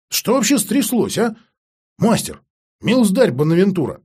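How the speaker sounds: high, loud anxious questioning; urgent demand. Acted. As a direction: press for answers, alarmed. Apprentice urgently demands answers.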